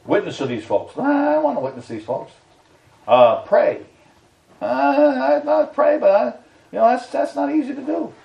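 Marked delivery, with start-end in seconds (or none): 1.0-2.3 whiny excuse. Acted. Acted reluctant believer's excuse. 4.6-8.1 whiny excuse. Acted. Acted reluctant believer's excuse.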